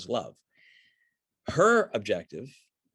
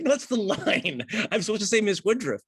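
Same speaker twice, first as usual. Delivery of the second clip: exasperated correction; 10 semitones higher, 4 dB louder than usual. Acted. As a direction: play a coach losing patience with a laugh. Acted coach correcting confused actress.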